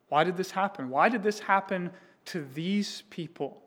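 ordinary delivery

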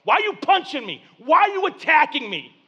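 mad heavenly voice. Acted. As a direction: confront him, building to an angry shout. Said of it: "and it's mad at me".

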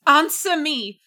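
loud, high outburst; desperate demand. A narrator's reading. Trapped wife to silent husband, loud desperate demand. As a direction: cry out for an answer.

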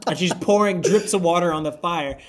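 ordinary talk, nothing marked

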